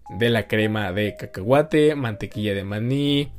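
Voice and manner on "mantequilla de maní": ordinary delivery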